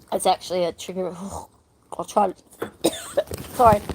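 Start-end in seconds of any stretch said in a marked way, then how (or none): 3.0-3.5 short cough to clear the throat. Real, not acted. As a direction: cough out a stuck hair. Hair stuck in throat.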